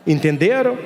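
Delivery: ordinary talk, nothing marked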